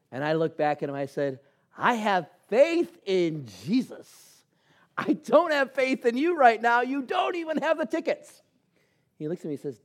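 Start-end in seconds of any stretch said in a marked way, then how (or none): 1.8-8.4 indignant retort. Retold or imitated. Retorts to friend without tickets.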